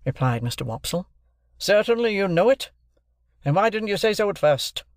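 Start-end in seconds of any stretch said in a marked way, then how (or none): 1.6-4.8 high, quicker incredulous echo; bullying interrogation. A narrator's reading. Stranger pounces on Wopsle's answer, bullying. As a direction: throw his answer back as a challenge. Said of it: "in a bullying, interrogative manner".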